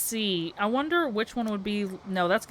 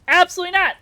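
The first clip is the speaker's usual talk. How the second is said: loud refusal; 10 semitones higher, 11 dB louder, much faster than usual. Real, not acted. Playful refusal to chat request.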